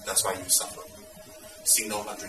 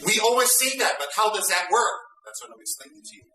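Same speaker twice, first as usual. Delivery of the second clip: higher, louder rhetorical questioning; animated challenge; 12 semitones higher, 5 dB louder than usual. Real, not acted. Teacher challenges students about compassionate anger, much higher and louder. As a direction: fire the questions at them.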